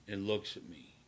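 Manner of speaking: usual speaking voice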